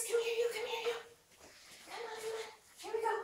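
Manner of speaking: high-pitched coaxing call to a dog; high excited calling. Real, not acted.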